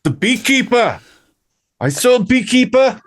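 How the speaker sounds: high-pitched South African impression; hammed-up South African movie-villain accent. Quoting the movie to co-hosts, South African accent. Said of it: "the best South African accent".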